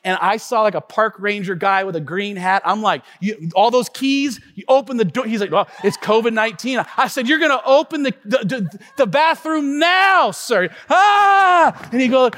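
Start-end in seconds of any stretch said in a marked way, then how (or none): 3.2-10.7 high, loud desperate order; loud, high mock demand to the park ranger. Demanding ranger open bathroom. 10.9-11.9 mock yell of frustration. Explosive cry of frustration.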